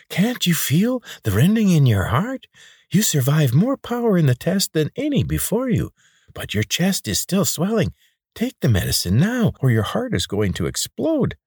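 high, oily wheeze pressed close; wheezing pudgy man. A narrator's reading. Pudgy man wheezing into the boy's face, high and urgent. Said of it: "wheezed in his face".

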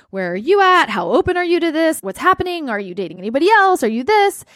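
high, rapid-fire retold questions; controlling interrogation. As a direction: fire off needy questions one after another. Reenacts anxious questioning of partner.

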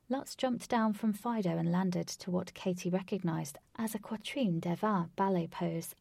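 ordinary delivery, nothing marked

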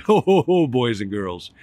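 Santa's loud entrance laugh to the class; booming Santa. Acted. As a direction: boom it out like a jolly Santa. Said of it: "He continued to shout".

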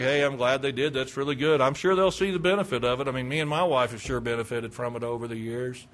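low, casual, uninvested approval; mild, offhand mock politeness; indifferent. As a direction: play a complacent churchgoer. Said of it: "Just indifferent as to whether".

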